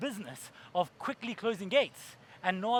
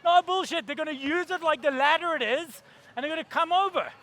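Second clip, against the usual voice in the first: loud urgent warning; 7 semitones higher, 6 dB louder than usual. Retold or imitated. Urgent warning retold loudly.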